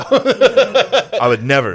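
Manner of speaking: mock innocent.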